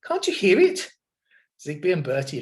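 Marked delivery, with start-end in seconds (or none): 0.0-0.9 high, urgent question; a high, anxious cry; frightened plea. A narrator's reading. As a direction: beg them to listen, scared. McMeer begs in fear.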